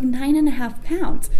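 lightly voiced technician, slow and even; tech's matter-of-fact estimate. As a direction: read the weight out slowly, like routine news. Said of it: "she's like".

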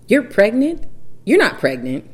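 high, loud disbelieving yelp; shocked disbelief. Retold or imitated. Husband hearing the pregnancy news, loud shocked denial. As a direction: act out a husband refusing to believe it. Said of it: "He was like".

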